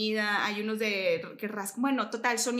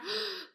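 sharp delighted intake of breath; quick thrilled gasp; excited gasp; 10 semitones higher, 8 dB quieter than usual. Real, not acted. Excited memory of school supplies.

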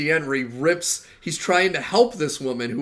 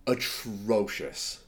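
disgusted; 5 semitones lower, 6 dB quieter, much slower than usual. Real, not acted. Judging a bad show.

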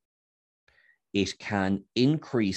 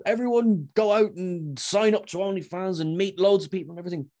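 higher-pitched hype pitch, jokingly; mock promotional plug; 10 semitones higher, 3 dB louder than usual. Speaker jokingly plugging a site to everyone, mock promotional plug. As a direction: play an over-eager plugger, voice lifted.

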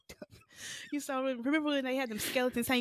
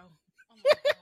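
high, loud squeal through real laughter; laughing; 10 dB louder than usual. Real, not acted. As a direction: burst out laughing mid-word. Cracking up at joke.

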